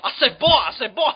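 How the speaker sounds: loud, high Southern cartoon rooster; Foghorn Leghorn. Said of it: "Fucking Froghorn Leghorn".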